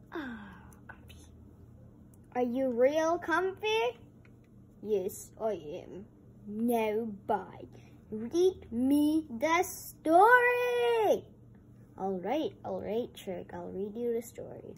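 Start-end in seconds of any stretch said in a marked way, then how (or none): 0.1-1.2 soft breathy whisper of comfort; whispered sigh of comfort. Pretend. Settling a toy in seat. 8.1-11.2 higher, louder, slow demand; slow, drawn-out demand; toy demanding story. Pretend.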